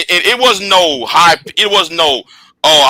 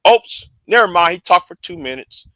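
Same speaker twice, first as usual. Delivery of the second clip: ordinary delivery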